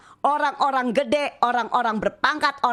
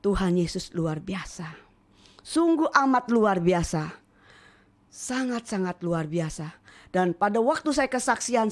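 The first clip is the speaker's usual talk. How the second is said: ordinary talk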